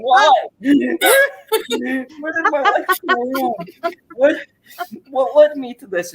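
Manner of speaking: high, wailing fake cry; hammed-up sob of despair; mock sobbing. Fan crying in his wife's lap over the show, mock wail.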